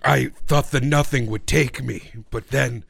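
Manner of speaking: low, heavy rock creature voice; deep, lumbering gloom; depressed rock man. Acted. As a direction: play a doleful stone giant confessing. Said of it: "you are a rock man with clinical depression".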